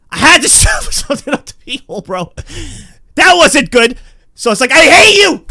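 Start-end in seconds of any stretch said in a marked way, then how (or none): 4.7-5.5 loud, high-pitched yell of loathing; hammed-up cry of hatred; hateful yell. Yelling at little Joey after the painful test, hateful. Cursing own body part.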